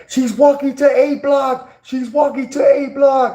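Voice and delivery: imitating an inmate hollering across the block; inmate yelling. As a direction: yell the news down the cell block, slowly. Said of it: "He was like".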